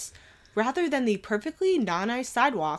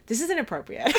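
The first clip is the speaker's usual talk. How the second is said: mock-prim objection through a laugh, pitched up; half-laughing, scandalized; laughing protest; 7 semitones higher, faster than usual. Friend Jenny watching the movie, laughing objection. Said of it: "and she was like".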